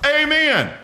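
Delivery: sudden high amen, bursting out. Retold or imitated. Preacher showing an amen escaping from worshipper, excited. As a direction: let the amen pop out of you high. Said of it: "That amen comes out of you".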